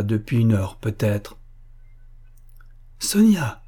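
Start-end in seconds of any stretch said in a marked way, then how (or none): 3.0-3.6 high, glad call; mild narrated shout, pitched high; crying out. A narrator's reading. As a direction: call her name out in happy surprise. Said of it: "cria Boris".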